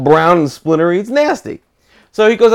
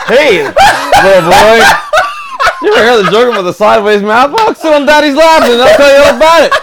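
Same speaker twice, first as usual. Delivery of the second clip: hammed-up lurer, rising higher and louder through laughter; creepy stranger; 7 semitones higher, 4 dB louder than usual. Creep luring a child to hear a joke, hammed-up and creepy. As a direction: coax loudly like a cartoon creep.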